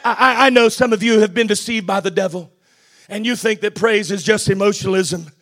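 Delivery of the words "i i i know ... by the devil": ordinary talk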